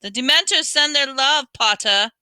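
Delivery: high, loud taunting shout; sneering schoolboy shout; Malfoy shouting. A narrator's reading. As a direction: jeer it across the crowd. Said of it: "who shouted through the crowd".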